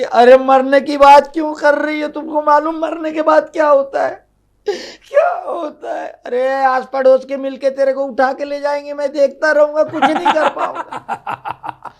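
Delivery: high, loud melodramatic wail; mock-tragic husband. Husband dramatizes her funeral to his wife, loud and wailing. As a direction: ham up the tragedy.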